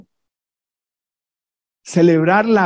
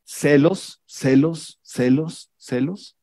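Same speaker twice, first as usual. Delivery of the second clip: each "celos" softer and softer, rising at the end; fading repetition; 4 semitones lower, 5 dB quieter, much slower than usual. Shown as a demonstration. Preacher hammering the sin into the listeners, then fading out. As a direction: let the word die away. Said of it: "celos repeated".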